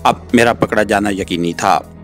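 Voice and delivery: ordinary delivery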